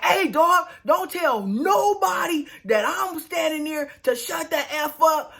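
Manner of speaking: high, slow-stretched mock challenge; confronting. Michael confronting the hotel manager, imitated angry challenge. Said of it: "I'ma check this man".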